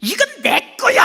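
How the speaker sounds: put-on loud, high whine like a child; father squabbling like a kid; childish father. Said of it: "아버지가 가서 또 이렇게 말합니다".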